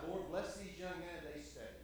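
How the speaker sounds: normal talking voice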